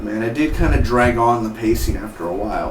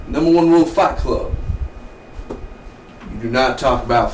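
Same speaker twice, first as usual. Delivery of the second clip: Fight Club rules; 3 semitones higher than usual. Retold or imitated.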